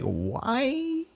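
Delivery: high, slow, drawn-out complaint; slow, high mock despair; exasperated whine. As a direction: whine it like a fed-up viewer pleading with the show. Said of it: "I'm just like".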